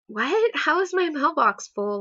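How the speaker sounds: high, incredulous retold reaction; baffled disbelief. Caller told her mailbox is full, baffled disbelief. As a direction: react as if that makes no sense.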